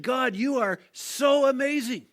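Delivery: high, slow, loud cry of worship; exultant praise. Retold or imitated. Crowd telling God after the solo, exultant praise.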